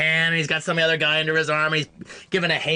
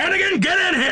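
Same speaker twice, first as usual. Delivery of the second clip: screaming Chicago boss impression; high, loud mock bellow; Ralph Meeker; 5 dB louder, faster than usual. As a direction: yell for your underling like a movie boss. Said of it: "Ralph Meeker screaming in the camera".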